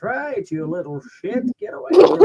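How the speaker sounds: put-on high cranky old man; grumpy geezer yelling. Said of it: "I always do the old man voice".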